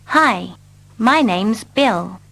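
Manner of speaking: ordinary delivery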